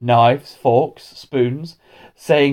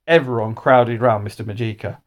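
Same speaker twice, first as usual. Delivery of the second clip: usual speaking voice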